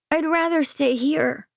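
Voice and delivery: put-on child voice, reluctant; young boy. Aiden's child voice.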